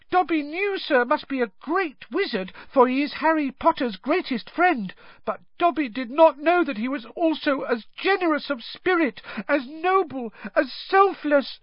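high, squeaky, reverent elf voice; squeaky house-elf. A narrator's reading. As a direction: gush with awed gratitude. Overwhelmed house-elf Dobby praising Ron.

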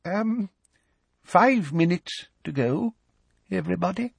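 weak, high professor's voice; frail, hesitant announcement; feeble. A narrator's reading. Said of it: "Professor Tofty's voice said feebly".

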